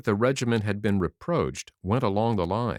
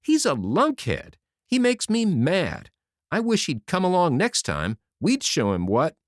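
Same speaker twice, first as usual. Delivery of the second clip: higher, louder, fed-up outburst; sudden exasperation; 8 semitones higher, 4 dB louder than usual. A narrator's reading. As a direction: snap with irritation at the general. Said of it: "exclaimed with sudden exasperation".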